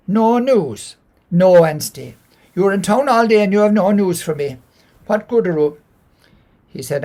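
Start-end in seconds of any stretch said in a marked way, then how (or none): 0.1-5.8 loud, high scolding wife. Acted. Wife scolding husband for bringing no news, loud and high. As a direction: give him a sharp, loud telling-off. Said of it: "she said".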